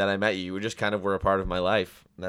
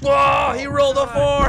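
high, loud yelling; excited shout; 8 dB louder, slower than usual. Acted. Friend gets sucked into game.

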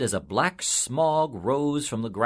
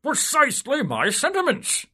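high, loud self-important politician; higher, louder pompous official voice; pompous civil servant; 4 dB louder than usual. A narrator's reading. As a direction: puff up and boast of your stellar record. Soul agrees with devil.

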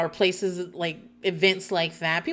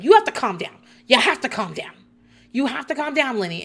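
urgent pleading; 4 semitones higher, 3 dB louder than usual. Retold or imitated. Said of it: "And Lisa is like".

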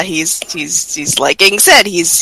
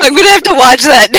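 laughing; 6 semitones higher, faster than usual. Real, not acted. Laughing at Ridley's Jersey voice.

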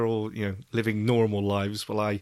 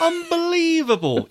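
high, drawn-out unbelievable; mock disbelief; 3 dB louder than usual. Real, not acted. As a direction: squeal it in fake shock. Mock outrage after being teased.

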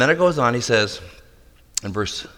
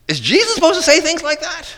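incredulous question; 12 semitones higher than usual. Real, not acted. Incredulous at Jesus' words.

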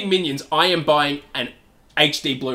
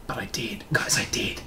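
whisper; 6 semitones lower, 10 dB quieter than usual. Real, not acted. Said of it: "whisper aside".

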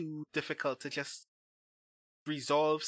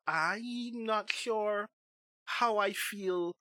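drawn-out doubt; 6 semitones higher, much slower than usual. Real, not acted. Hesitant doubt about movies.

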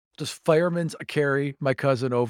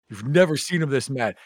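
usual speaking voice

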